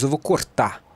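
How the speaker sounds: "cortar" said as "cortá", no final R; R final omitido. Shown as a demonstration. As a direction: drop the R at the end of "cortar". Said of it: "Você diz". ("cortar" dito "cortá", sem R no fim)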